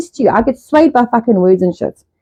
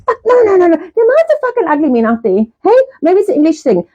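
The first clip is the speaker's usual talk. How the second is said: high mock dismay; 8 semitones higher than usual. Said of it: "I was like".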